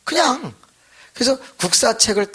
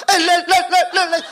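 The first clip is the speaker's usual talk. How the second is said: much higher, louder babbling trill; speaking in tongues; 5 dB louder than usual. Retold or imitated. Beaten boy suddenly speaking in tongues, laughing trill.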